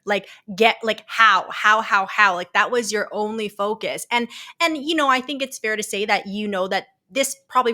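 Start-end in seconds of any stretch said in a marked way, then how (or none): 0.0-2.3 louder, urgent mock pleading; a frantic retold "how, how, how"; frantic imitation. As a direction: imitate someone desperate to get out. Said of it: "you're like".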